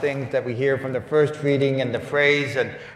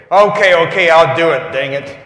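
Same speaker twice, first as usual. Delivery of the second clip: loud, exasperated giving in; grudging yes; 3 semitones higher, 11 dB louder than usual. Retold or imitated. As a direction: throw it out loud like a fed-up surrender. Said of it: "We may say".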